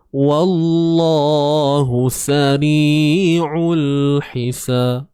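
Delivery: drawn out, melodic tajwid chanting; slow Quranic recitation. Shown as a demonstration. Teacher recites verse for students, slow chant. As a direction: chant each syllable slowly and evenly. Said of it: "teacher recites the verse slowly".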